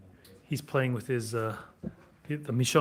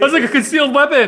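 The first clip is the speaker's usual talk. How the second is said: laughing; 10 semitones higher, 11 dB louder than usual. Real, not acted. Laughs at a matching sword.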